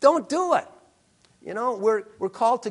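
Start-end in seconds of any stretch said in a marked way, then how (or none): 0.0-0.7 higher, louder, put-on voice; a mock warning voice, much higher; warning inner voice. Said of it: "someone you feel, uh, no".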